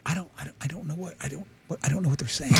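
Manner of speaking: soft, low, halting confusion; bewildered buddy. Retold or imitated. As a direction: imitate a baffled friend murmuring. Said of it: "And he's like".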